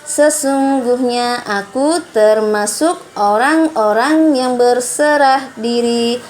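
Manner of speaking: slow, loud, measured reading of the translation; formal choral reading. Acted. Teacher reading the verse's meaning in unison with pupils, loud and slow. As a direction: read it out together, solemn and deliberate. Reads translation aloud with class.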